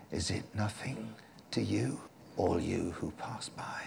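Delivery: very soft, half-whispered plea; hushed appeal. Retold or imitated. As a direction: drop almost to a whisper, imploring. Quotes a hushed appeal to passers-by.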